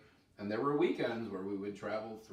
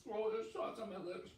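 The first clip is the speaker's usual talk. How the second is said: ordinary talk, nothing marked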